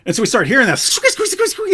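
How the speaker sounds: normal talking voice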